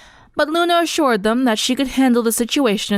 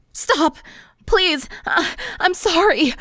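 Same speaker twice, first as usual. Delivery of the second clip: higher, pleading, panicked cries; desperate croak; 9 semitones higher, slower than usual. Acted. Bullied girl begs classmates to stop, croaking in panic. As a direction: beg the bullies to stop, voice cracking. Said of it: "she croaked".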